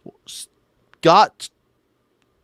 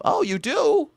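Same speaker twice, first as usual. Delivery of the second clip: squeaky high, hammed-up surprise; a put-on high-pitched gasp of interest; mock surprise; 14 semitones higher, slower than usual. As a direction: act startled and flattered. His acted reply to matchmaking.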